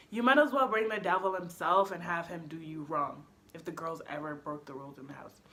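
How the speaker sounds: ordinary talk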